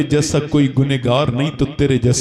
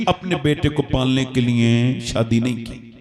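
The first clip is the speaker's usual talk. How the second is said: raised, fervent preaching to the crowd; impassioned sermon voice; preaching at full force; 5 semitones higher than usual. Acted. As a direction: preach it out over the hall. Preacher addressing congregation.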